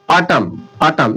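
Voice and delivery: ऑटम said slowly, ending on m with no n; silent n. Shown as a demonstration. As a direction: stretch ऑटम with the final n dropped. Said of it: "ये silent हो गया". (धीरे से ऑटम, एन silent)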